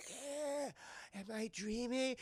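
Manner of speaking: high and soft, half awake; groggy and dazed. Retold or imitated. Wounded woman asking her rescuer, soft dazed voice. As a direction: mumble like you just came to.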